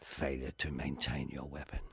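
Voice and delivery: low, soft film-star menace; Michael Caine. Acted. Quoting the actor's film, quiet low impression. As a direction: quote the film line low and measured. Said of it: "Michael Caine talks like that now".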